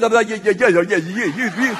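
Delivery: loud, stuttering mock panic; tongue-tied babbling. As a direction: babble as if unable to form words. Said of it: "I cannot form words".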